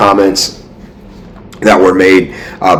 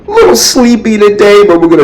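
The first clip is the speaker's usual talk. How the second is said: laughing; 13 semitones higher than usual. Real, not acted. Laughing while apologising for sleepiness.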